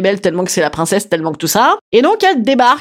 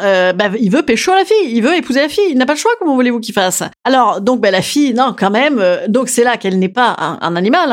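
usual speaking voice

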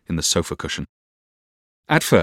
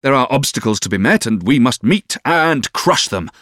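impassioned, rousing oratory, raised voice; warming to it; 5 semitones higher, 5 dB louder than usual. A narrator's reading. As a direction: exhort her with mounting fervour. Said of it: "warming with the subject".

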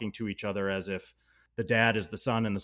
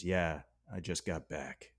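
low, slow and quiet, utterly flat; a mild imitation of a deadpan teen; deadpan Veronica quote; 5 semitones lower, 8 dB quieter than usual. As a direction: toss it off like nothing happened. Deadpan reply in retold scene.

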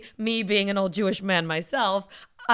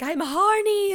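higher, lightly voiced childish woman; a hint of a whiny girl; mock girlish voice; 10 semitones higher, slower than usual. Voicing woman in attic.

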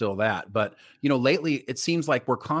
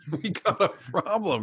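laughing; 4 semitones higher, slower than usual. Real, not acted. Echoing while laughing.

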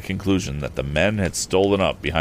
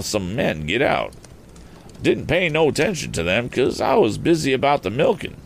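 higher-pitched rustic storytelling; country farmer; 6 semitones higher than usual. Acted. Farmer telling what he saw at dusk, higher rustic voice. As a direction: recount it in homespun farmer tones. Farmer figure gives account.

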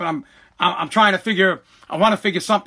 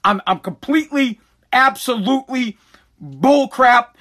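usual speaking voice

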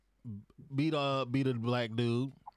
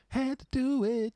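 high falsetto; 5 dB louder than usual. Shown as a demonstration.

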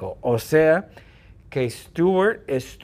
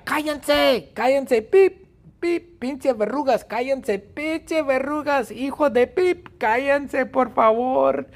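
high shrill-free mock yell, pitched way up; yelling at the warts; 13 semitones higher, 3 dB louder than usual. Stewie yelling at his chatty warts, high mock shout. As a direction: shout them down, exasperated and high. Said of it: "Y Stewie les grita".

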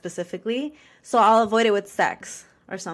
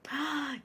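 sharp gasp; 7 semitones higher, 9 dB quieter than usual. Real, not acted. Reacts to partner being stuffer.

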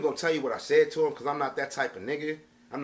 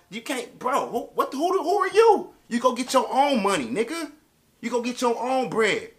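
much higher and louder, indignant yelling; angry outburst; 8 semitones higher, 5 dB louder than usual. Real, not acted. Telling off a friend who wants money, a high furious yell. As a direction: blow up at him, demanding who he thinks he is.

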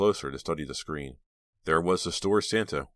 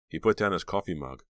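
ordinary delivery, nothing marked

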